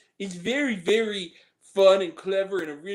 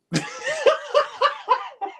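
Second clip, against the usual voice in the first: high shrieking laugh; squealing laugh; 3 dB louder than usual. Real, not acted.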